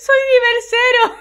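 high, loud mock-proud shout; gleefully announcing her own level zero; laughing proclaim. Real, not acted. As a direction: crow it out through laughter. Repeating joke louder, laughing.

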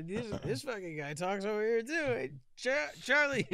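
very high, squeezed-up comic call; hammed-up high cartoon shout; turtle calling out. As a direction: call a pal over in a silly high voice. Playing turtle calling over Charlie.

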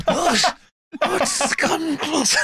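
put-on very slow, high frail old man; dying old man voice. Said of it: "sounded like a dying old man".

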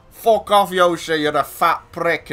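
Manchester drugs lord. Retold or imitated. Gamer teasing his friend's online persona, mock Manchester drugs lord. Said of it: "sound like some fucking drugs lord from Manchester".

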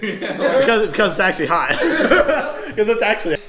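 high, loud, laughing admission. Real, not acted. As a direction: crack up while admitting it. Admits the heat, laughing loudly.

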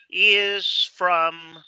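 "is" hit loud and high; "is from" said slowly with weight on "is"; stressed is from. Shown as a demonstration. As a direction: lean on "is" in "is from". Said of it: "cuando tú dices is from".

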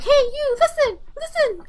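high, piping fairy nagging; squeaky, insistent pestering; Navi. Retold or imitated. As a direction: mimic an annoying little game fairy. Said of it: "It was so annoying".

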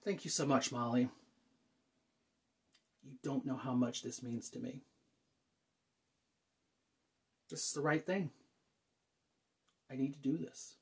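soft, low, heartfelt; hushed and sincere; sincere gratitude. Acted. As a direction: drop to a quiet, earnest near-murmur. Sincere thanks, steeling self before arrival.

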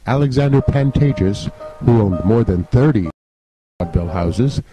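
ordinary delivery, nothing marked

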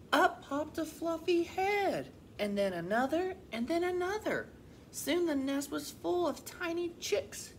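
lively, expressive picture-book reading; animated storybook voice; children's story narration. A narrator's reading. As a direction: read to young children with clear, lilting emphasis. Reads a picture book aloud.

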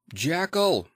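sharp, high shout of a name through a closed door; loud call. A narrator's reading. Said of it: "cried Utterson with a loud voice".

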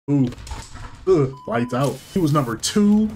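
usual speaking voice

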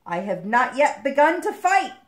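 loud, high battle cry; rousing declaration, rising to the last word; defiant proclamation. Acted. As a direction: proclaim it boldly to the enemy. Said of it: "Bentley proclaimed".

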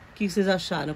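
ordinary delivery

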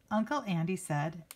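plain reading voice

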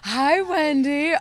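high, slow gushing hello; gushing greeting. Real, not acted. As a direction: greet her with over-the-top delight. Greets arriving friend with gushing voice.

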